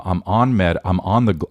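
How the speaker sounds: normal talking voice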